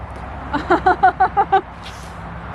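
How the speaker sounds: real laugh. Real, not acted. Laughing at own mistake.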